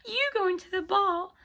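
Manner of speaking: high, haughty stepsister laughing scornfully; mocking laughter. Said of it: "Cinderella's stepsisters laugh".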